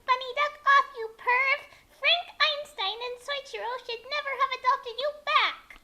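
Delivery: loud, high furious yell; screamed. A narrator's reading. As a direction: yell at the intruder, pitched high. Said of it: "she screamed".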